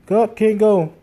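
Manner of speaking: high, loud exasperated cry; frustrated outburst. Real, not acted. Telling a friend he missed the [name] tour, loud frustrated outburst. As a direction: blurt out the letdown loud and high. Frustrated about missing the concert.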